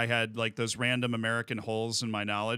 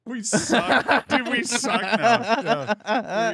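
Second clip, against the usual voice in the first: 1 mock despair; 10 semitones higher, 9 dB louder, much slower than usual. Real, not acted. Fan laments his team.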